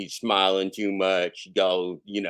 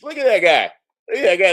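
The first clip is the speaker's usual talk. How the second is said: excited disbelief; 8 semitones higher, 8 dB louder, faster than usual. Real, not acted. Pointing at video.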